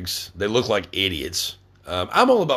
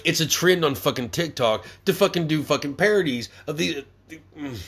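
normal talking voice

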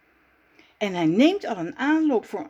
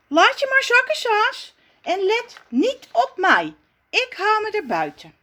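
high, louder calling voice; Raf's voice; 11 semitones higher, 4 dB louder than usual. Acted. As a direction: call up to her, proud to stay calm. Raf calling up to Zaza. (Raf roept omhoog naar Zaza, hoog en luid)

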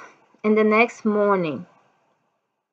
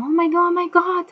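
much higher, fast alarmed oh my God; panicked mom; 10 semitones higher, faster than usual. Retold or imitated. Mother panicking at her bleeding child, high and fast. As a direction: panic at the blood. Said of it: "And my mom".

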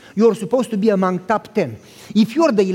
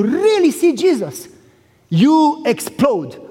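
drawn way out, each word weighted; slow, heavy stress on explode; slow emphatic; 5 semitones higher, slower than usual. Acted. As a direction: let it land slowly. Preacher drives home point.

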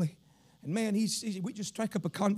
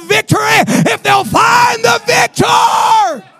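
impassioned preaching build; 8 semitones higher, 16 dB louder than usual. Real, not acted. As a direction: rise steadily into high, loud preaching. Preacher builds to full force.

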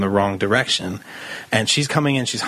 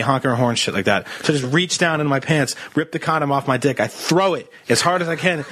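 ordinary delivery

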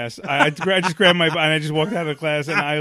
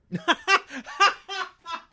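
high burst of laughter; real laugh. Real, not acted. Laughing at his own awkward opening line.

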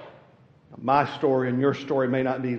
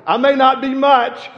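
high, loud, eager answer to God; volunteer with your whole heart; a preacher lightly voicing Isaiah's eager reply; fervent Isaiah; 10 semitones higher, 8 dB louder, slower than usual. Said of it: "as if Isaiah says".

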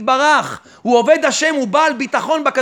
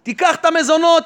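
ordinary talk

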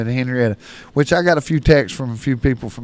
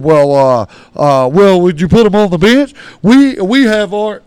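insistent stranger; 5 semitones higher, 9 dB louder than usual. Retold or imitated. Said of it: "he said".

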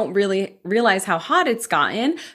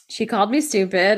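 normal talking voice

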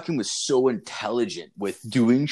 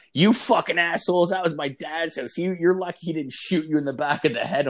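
mock angry girl; 6 semitones higher than usual. Classmate angry about her dad.

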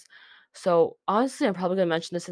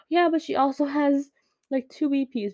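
higher-pitched, mildly defensive fan correction; eager superfan; 7 semitones higher than usual. Retold or imitated. Fan correcting cousin about the singer's releases, eager superfan. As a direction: chime in high, playing the keen fan. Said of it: "[name] fan ass was like".

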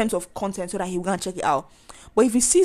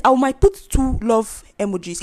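ordinary talk, nothing marked

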